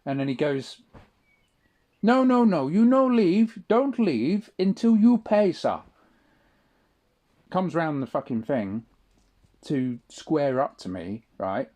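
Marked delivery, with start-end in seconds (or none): 2.0-5.8 loud, high and insistent, no no no; shouting cashier. Retold or imitated. Cashier shouting at him not to leave without paying, loud and high. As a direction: play the worker barking him back to pay.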